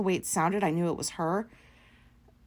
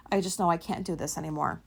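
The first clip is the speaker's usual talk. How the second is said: normal talking voice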